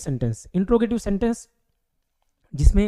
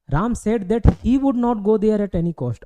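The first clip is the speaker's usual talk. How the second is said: slow dictation; 4 semitones higher, much slower than usual. Shown as a demonstration. Teacher reads indirect speech example.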